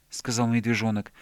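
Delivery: usual speaking voice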